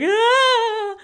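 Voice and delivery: loud, high, hammed-up wailing sob; mock ugly-cry wail. Podcaster acting out ugly crying in the car, hammed-up wail. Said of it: "and you're like".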